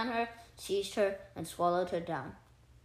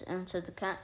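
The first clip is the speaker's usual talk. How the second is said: plain reading voice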